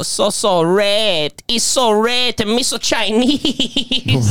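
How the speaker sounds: hammed-up Chinese accent quoting the messages; mock Chinese accent. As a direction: stretch each word in a mocking Chinese accent. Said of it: "He goes".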